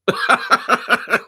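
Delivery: loud high burst of laughter; real laugh. Real, not acted. Host confessing dislike of an accent, laughing hard. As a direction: crack up in rapid high pulses.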